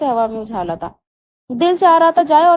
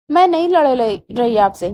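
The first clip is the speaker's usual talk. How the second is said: plain reading voice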